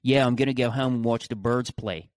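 imitating a local Philly accent. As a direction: show off Philly vowels, casually. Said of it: "Like that".

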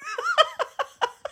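sudden high giggle; high burst of laughter. Real, not acted. Daughter bursts out laughing at joke.

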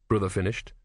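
ordinary delivery, nothing marked

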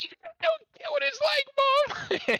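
put-on teen yelling at his mother at 2 AM, whiny tantrum; temper tantrum. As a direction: stretch it out like a sulking kid yelling at mom. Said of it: "throwing a temper tantrum".

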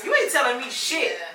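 slow defiance. Retold or imitated. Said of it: "And I walked off".

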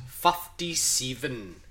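drawn-out New Zealand "fifty-seven", vowels squashed; New Zealand accent. Retold or imitated. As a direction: imitate a New Zealander, slowly. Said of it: "New Zealanders say it like this".

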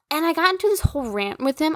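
ordinary talk

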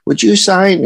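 imitating a fangirl. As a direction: gush the request like a fan. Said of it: "they fangirl and go".

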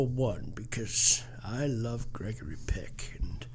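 hushed secretive whisper. Acted. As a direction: whisper it like a confession. Boasting about his own pick.

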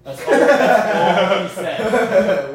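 slow, loud laughing words. Real, not acted. Retelling the Brit's question to friends while laughing hard. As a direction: laugh it out, stretched. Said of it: "laughing retelling".

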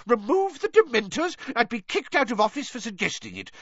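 high-pitched, hurried protest; blustering official, incredulous and agitated; outraged. A narrator's reading. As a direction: argue back heatedly, voice high. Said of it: "shouted Fudge again".

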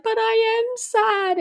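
put-on high, slow, whiny kid voice; imitating crying son. Imitating a crying son answering his YouTuber mum, high and slow. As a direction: play the upset little boy pleading.

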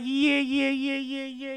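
very high, very loud yeahs; excited yeah yeah. Retold or imitated. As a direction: shout yes in pure glee. Both sides overjoyed.

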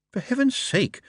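high exasperated burst; exasperated plea to the horse. Acted. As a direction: throw up your hands at the horse. Moist exasperated.

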